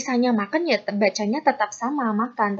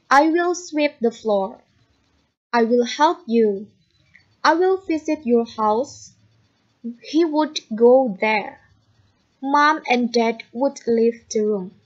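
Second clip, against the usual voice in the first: slow, careful English sentences; slow model reading; slower than usual. Shown as a demonstration. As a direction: read each slowly for the class. (membaca contoh kalimat dengan lambat)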